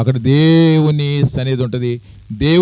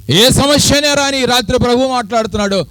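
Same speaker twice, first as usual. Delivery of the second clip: preaching at full force; 9 semitones higher than usual. Acted. Preacher proclaiming God's names to the congregation, fervent. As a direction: pile up God's titles in rising fervour.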